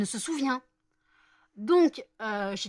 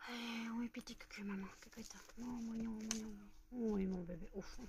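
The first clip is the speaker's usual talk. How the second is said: doting pet cooing; 3 semitones higher, 10 dB quieter than usual. Real, not acted. Cooing nicknames to the pet, tender and hushed. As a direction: coo sweet nicknames under your breath.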